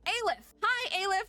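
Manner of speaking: high-pitched delighted fan greeting; excited greeting. Real, not acted. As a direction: squeal hello to a favourite character. Greets a favourite game character.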